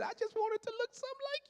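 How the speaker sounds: high, fast mock woman's voice; mocking the lady. Preacher mimics the lady's request, high mock voice. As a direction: squeak her words back at her.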